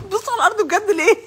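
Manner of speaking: high, incredulous scolding; incredulous mock outrage. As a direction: scold him in shocked disbelief.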